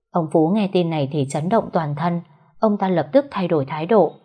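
ordinary delivery, nothing marked

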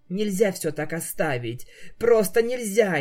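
near-hysterical insistence. Acted. Twilight refusing to give up on her friend, hysterical. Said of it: "истерила Твайлайт".

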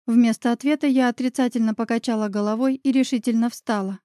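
ordinary delivery

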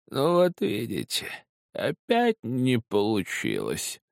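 high, slow, quavering lisp; rattling, slightly lisping voice. A narrator's reading. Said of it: "раздался дребезжащий, слегка шепелявый голос". (дребезжащий, слегка шепелявый голос)

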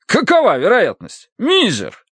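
high, astonished colonel; amazed. A narrator's reading. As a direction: exclaim in wonder at the odds. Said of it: "изумлялся полковник". (изумлённо, на высокой ноте)

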